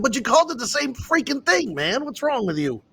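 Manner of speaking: high, exasperated opening that falls back; indignant rant at the friend; heated complaint. Retold or imitated. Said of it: "I called him all hot and heated".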